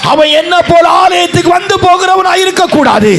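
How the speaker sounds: loud, high pleading cry to God; Hannah's vow shouted from the pulpit; shouted prayer. Acted. Hannah's vow voiced at climax.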